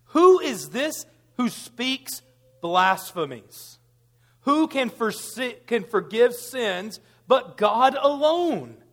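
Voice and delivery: slow, drawn-out indignant question; slow scandalized reading; slow accusing read. Acted. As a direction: drag out the scribes' outrage.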